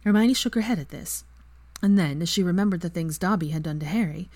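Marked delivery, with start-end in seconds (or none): none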